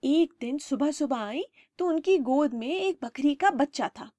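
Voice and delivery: ordinary delivery, nothing marked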